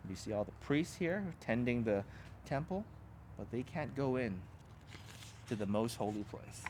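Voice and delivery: ordinary delivery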